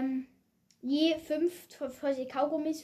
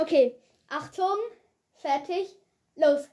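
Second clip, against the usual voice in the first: normal talking voice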